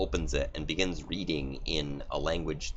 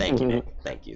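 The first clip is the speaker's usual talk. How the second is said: mock thanks; 8 semitones higher, 5 dB louder, faster than usual. Real, not acted. Mock grateful exclamation.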